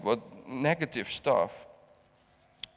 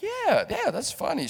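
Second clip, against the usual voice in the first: high mock reply; 12 semitones higher than usual. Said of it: "and she was like".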